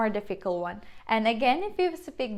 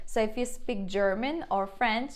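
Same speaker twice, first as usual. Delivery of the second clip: ordinary talk, nothing marked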